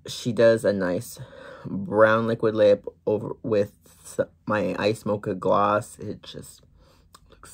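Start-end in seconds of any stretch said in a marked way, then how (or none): 6.0-7.5 soft whispered praise. Real, not acted. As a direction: breathe it out admiringly. Whispering admiration of swatch.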